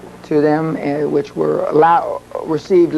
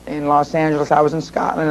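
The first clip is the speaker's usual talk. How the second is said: ordinary delivery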